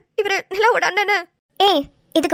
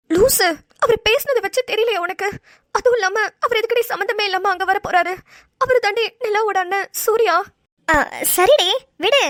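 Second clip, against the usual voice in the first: ordinary delivery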